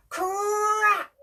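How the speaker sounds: high, loud crack; shouted crack. Shown as a demonstration. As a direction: shout it with the children on three. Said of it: "Can you shout out, "Crack"?".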